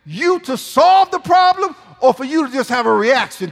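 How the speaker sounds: loud, driving sermon climax; preaching shout. Acted. Pastor pressing the congregation with a shout. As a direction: build it to a shout. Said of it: "preaching at full force".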